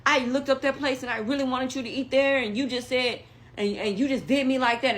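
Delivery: high, loud upset rant; upset complaint. Retold or imitated. Date going off at him.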